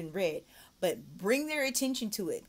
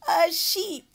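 laughing through "A sheep"; real laughing correction; laughing at mistake; 12 semitones higher, 7 dB louder than usual. Real, not acted. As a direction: laugh at yourself. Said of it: "still having cow on the brain".

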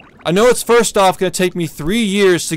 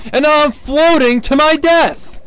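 angry rant; 7 semitones higher, 3 dB louder than usual. Real, not acted. Streamer raging at soft-lock death.

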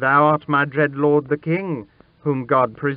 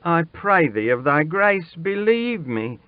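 put-on high, urgent boy's plea; imploring, pitched high and building; desperate pleading; 9 semitones higher, 4 dB louder than usual. A narrator's reading. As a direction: beg for your life. Boy begs king for his life.

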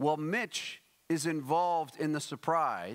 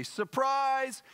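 high-pitched party "Surprise!"; mock surprise shout; 11 semitones higher, 3 dB louder than usual. Preacher opening Fellowship Hall doors to Mitch, excited surprise call. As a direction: sing it out high, as if springing a party. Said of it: "I say".

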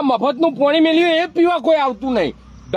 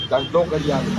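mocking mimicry; 10 semitones lower, 4 dB quieter than usual. Repeating mocking line.

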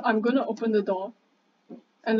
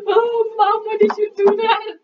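high, loud scared laugh-whine at Mom; shaken, half laughing, half crying; 9 semitones higher, 6 dB louder than usual. Real, not acted. Calling to Mom after she killed the lights, high scared laugh-cry.

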